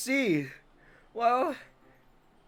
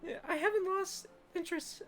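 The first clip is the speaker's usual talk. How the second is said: put-on anime girl voice; 9 semitones higher, 5 dB quieter than usual. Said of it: "best girl voice I got".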